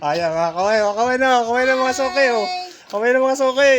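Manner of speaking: loud, high call to wave. Real, not acted. Collector calls on his regulars to wave, loud and high.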